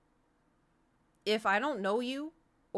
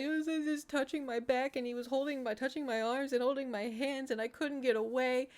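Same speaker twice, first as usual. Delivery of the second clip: higher, choked retelling through tears; retold sobbing; 6 semitones higher than usual. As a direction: tell it like you're still crying a little. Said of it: "I start sobbing".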